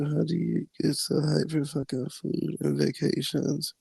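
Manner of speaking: slow, soft mocking echo of the question; mocking a hater. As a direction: drawl it back like a dumb question.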